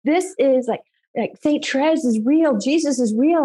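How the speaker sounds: awestruck insistence. Retold or imitated. Wife telling husband what she now knows is real, awestruck insistence. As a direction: insist on each new realization.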